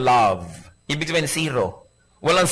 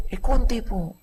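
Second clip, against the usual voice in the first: meek answer; 6 semitones higher than usual. Acted. Wife admits answering back.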